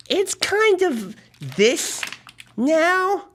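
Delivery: drawn out, reluctant bad news; hesitant confession. Reluctantly confesses breaking borrowed toy.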